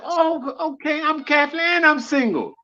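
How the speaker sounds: high-pitched, softly eager reply; eager, high-voiced assent from a hopeful man; eager cab driver. Cab driver assuring the nun he qualifies, eager.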